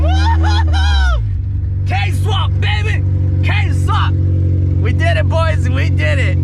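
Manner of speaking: loud high whooping celebration; triumphant cheering. Real, not acted. Celebrating the car running.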